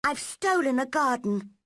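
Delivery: plain reading voice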